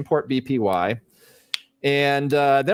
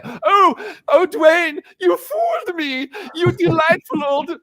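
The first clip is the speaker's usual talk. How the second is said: much higher and louder, hammed-up fawning cry; mock gushing flattery; 5 dB louder, slower than usual. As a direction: overplay being fooled, sarcastic delight. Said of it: "what am I supposed to say?".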